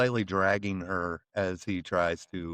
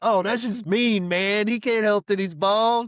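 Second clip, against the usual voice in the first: high, loud mock pity; mock sympathy; 10 semitones higher, 5 dB louder than usual. Friend mocking the bald joke, high loud mock pity. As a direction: pretend to feel sorry for him, overdone and laughing. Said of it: "I was like".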